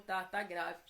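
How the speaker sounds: low, quiet husband's answer; husband's reply. Retold or imitated. Wife recalls husband confirming a heart attack, low quiet reply.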